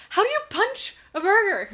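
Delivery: loud, high disbelieving shout; half-laughing outraged question; incredulous yell. Retold or imitated. Retold incredulous question to coworker.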